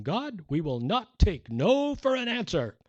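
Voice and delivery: mock a brash faith preacher; bold faith teacher. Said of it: "a faith teacher one time saying".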